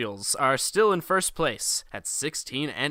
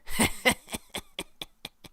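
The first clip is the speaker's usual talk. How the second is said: Bill Burr laugh; 10 semitones higher than usual. Retold or imitated.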